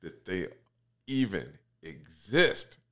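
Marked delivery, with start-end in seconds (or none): none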